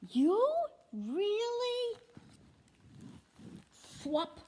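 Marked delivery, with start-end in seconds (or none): 0.1-2.0 high, loud surprised character; a cartoon figure startled and thrilled; mock surprise. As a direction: gasp it out delighted.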